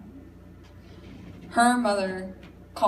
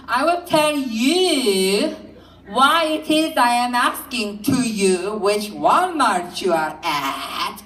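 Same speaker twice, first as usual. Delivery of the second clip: sing-song mother; slower than usual. Retold or imitated. Mother explaining to her son in a slow singsong. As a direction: draw the words out in a slow singing tune. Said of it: "a kind of a singing thing going on".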